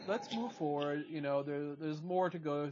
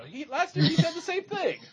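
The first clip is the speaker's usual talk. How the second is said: laughing; 6 dB louder than usual. Real, not acted. Teasing friend, laughing.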